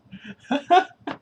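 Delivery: short high burst of laughter; real high giggle burst; laughing. Real, not acted. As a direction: crack up briefly, high and loud. Laughing at own butter demo.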